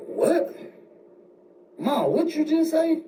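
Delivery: high-pitched, stunned questioning; shocked disbelief. Retold or imitated. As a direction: imitate a stunned son demanding an explanation. Shocked son overhears mother's remark.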